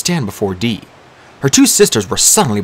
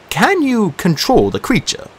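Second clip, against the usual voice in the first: slow, higher curious question; 11 semitones higher than usual. A narrator's reading. Machiavelli curiously asking the Disir about the creature. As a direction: ask with genuine interest. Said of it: "Machiavelli asked curiously".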